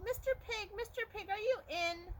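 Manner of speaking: high sing-song call at the door. Acted. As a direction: sweetly call for the pig. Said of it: "I called".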